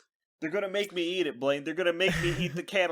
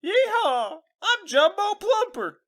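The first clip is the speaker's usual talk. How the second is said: high, twangy showman voice, slow at the end; hammed-up cowboy character; 11 semitones higher, slower than usual. Introducing the made-up character Jumbo Plumper in a high cowboy voice. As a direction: greet the kids like a goofy cowboy star. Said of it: "Somebody's into it".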